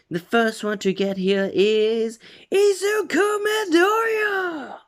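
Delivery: high, loud stadium announcer shout. Acted. President Mike announcing Deku won the race, loud shout. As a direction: bellow the winner's name to the whole crowd.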